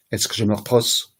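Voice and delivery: "Est-ce que je me repose" run together on one level; flat French intonation. Shown as a demonstration. Teacher illustrating French intonation, "Est-ce que je me repose" all on one level. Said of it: "all on one flat level".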